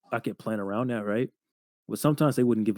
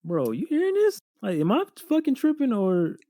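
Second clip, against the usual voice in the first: much higher, incredulous aside; can you believe this, pitched way up; stunned disbelief; 13 semitones higher than usual. Retold or imitated.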